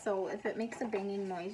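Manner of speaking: usual speaking voice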